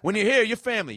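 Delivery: high, loud ad slogan voice; hyped ad slogan. Retold or imitated. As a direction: sell the slogan loudly.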